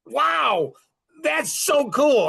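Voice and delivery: high, drawn-out marvelling; excited amazement. Retold or imitated. Husband awed by his wife's breakthrough, excited. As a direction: marvel at it slowly, pitched right up. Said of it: "I get excited".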